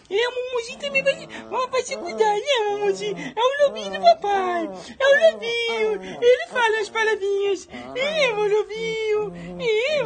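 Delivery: put-on high, whiny baby-talk pet voice, near tears; pet's voice. Dad voicing his pet missing its father, high whiny baby voice. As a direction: squeal it like a homesick little pet.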